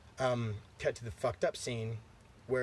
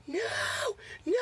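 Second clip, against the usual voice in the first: high, drawn-out imitated scream; mock horrified wail. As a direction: wail it like a mother freaking out. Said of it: "freaking out, right?".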